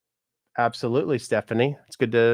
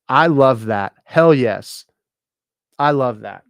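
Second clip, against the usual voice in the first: real excitement; 2 semitones higher, 8 dB louder, slower than usual. Real, not acted. Cheering a viewer's comment.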